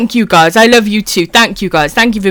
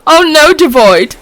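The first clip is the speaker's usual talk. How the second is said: usual speaking voice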